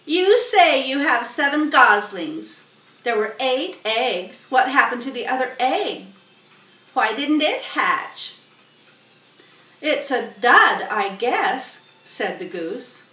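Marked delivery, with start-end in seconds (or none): 0.1-8.3 high, loud sharp rat voice. Acted. Templeton addressing the goose, sharp. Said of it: "in his sharp voice". 9.8-11.8 louder offhand goose; goose's voice. Acted. Goose answering Templeton, offhand and loud.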